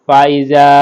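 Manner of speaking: normal talking voice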